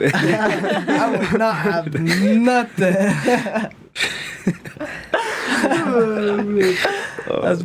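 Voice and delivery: laughing at his own phrase with friends. Real, not acted. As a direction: giggle out the words slowly.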